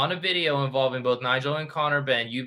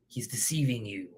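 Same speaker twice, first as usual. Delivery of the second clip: whispered, secretive; sly whisper; 2 semitones lower, 7 dB quieter than usual. Pretend. Vlogger hinting at deception to viewers, in a whisper. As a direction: hush it like a conspirator. The art of deception, spooky aside.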